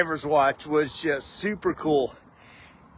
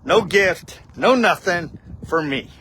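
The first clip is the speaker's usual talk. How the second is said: ordinary talk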